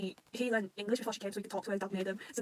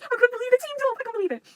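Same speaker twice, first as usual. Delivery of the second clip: high-pitched disbelieving squeal; freaking out; 8 dB louder than usual. Real, not acted. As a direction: gush loudly in thrilled disbelief. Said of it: "I freaked out when I saw this".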